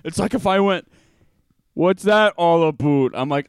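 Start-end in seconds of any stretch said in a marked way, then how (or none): 1.7-3.1 loud, high, drawn-out British mimicry; slow, exaggerated British put-on; mock British accent. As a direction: tease with an over-the-top British accent. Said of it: "kept doing an accent of him".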